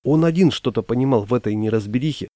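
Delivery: plain reading voice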